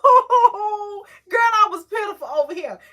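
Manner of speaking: loud, high, tearful wail; half laughing, half sobbing at herself; crying out. Retold or imitated. Mother recalling how pitiful she was, crying loudly. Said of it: "I was like".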